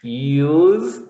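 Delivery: "Use" with a buzzing voiced z; Z sound. Shown as a demonstration. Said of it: "you would say like this".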